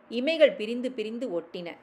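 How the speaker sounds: ordinary delivery, nothing marked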